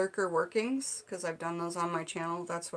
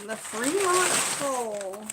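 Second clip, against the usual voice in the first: dramatic slow repeat; much slower than usual. Pretend. Naming local landmark.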